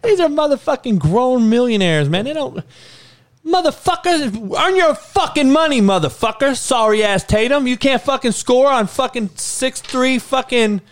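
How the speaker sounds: high-pitched furious yelling at the players; furious coach yell. Retold or imitated. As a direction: scream at lazy millionaires to earn their money. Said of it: "That's what I tell him".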